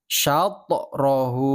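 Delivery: "Syatorahu" slow, the t bouncing into a light o, "sya-to-rahu". Shown as a demonstration. Teacher models correct qalqalah for pupils, slow and bouncing. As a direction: let the t in "Syatorahu" rebound. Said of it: "Syatrohu itu salah".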